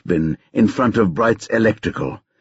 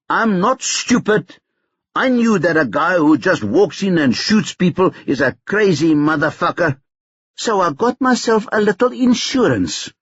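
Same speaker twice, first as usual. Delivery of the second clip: put-on higher, brash young hustler taunting on the phone; cocky Tyrone; 7 semitones higher than usual. A narrator's reading. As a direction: needle him, sure you hold the cards.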